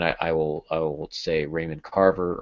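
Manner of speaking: ordinary talk, nothing marked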